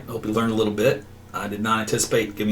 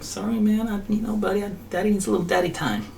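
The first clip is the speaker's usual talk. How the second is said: higher, sheepish excuse to the pet; apologetic dad to dog; 10 semitones higher than usual. Retold or imitated. Owner excusing himself to his dog, higher and sheepish. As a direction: let him down gently, a little guilty. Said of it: "I'm just like".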